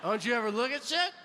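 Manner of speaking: high, rising indignant challenge; mock-indignant squawk; defensive retort. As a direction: turn the question back on them, pitch climbing. Defensive retort about squinting.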